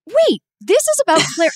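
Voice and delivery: high, loud gasp of recognition; sudden realization. Retold or imitated. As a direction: it finally dawns on her. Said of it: "and I went".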